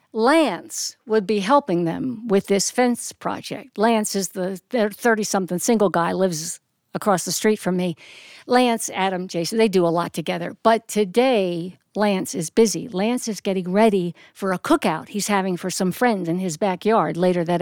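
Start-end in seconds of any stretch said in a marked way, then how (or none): none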